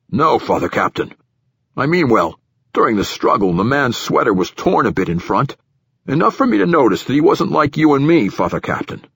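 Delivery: official giving his account to a superior, a bit pushed; nervous platform director. Acted. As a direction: voice an uneasy director justifying himself.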